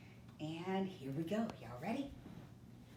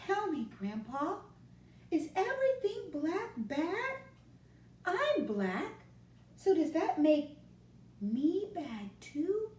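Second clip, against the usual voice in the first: put-on higher, pleading little boy asking his grandpa; a child rising in worry as he asks; small boy begging grandpa for an answer; 11 semitones higher, 5 dB louder than usual. Moncho's child voice held.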